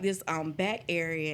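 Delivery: normal talking voice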